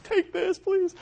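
high, pleading little voice; pleading kid. Acted. Boy begs friend to swap papers, squeaky plea. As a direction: beg him to take the paper. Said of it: "He's like".

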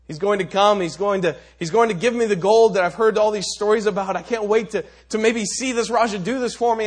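loud, high, eager rush of hope; excited beggar. Acted. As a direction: beggar thrilled the Raja will help him, eager and loud. Beggar's heart leaps with hope.

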